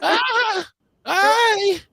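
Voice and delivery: imitating a friend's shocked high squeal; imitating Courtney. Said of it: "Courtney said".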